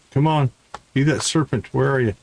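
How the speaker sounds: normal talking voice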